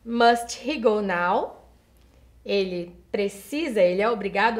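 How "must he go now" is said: "must he go now?" asked slowly; slow model question. Shown as a demonstration. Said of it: "Por exemplo".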